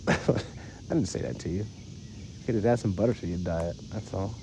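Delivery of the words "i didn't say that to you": breathy laughing through it, slow; softer slow chuckling "I didn't say that to you"; laughing denial. Real, not acted.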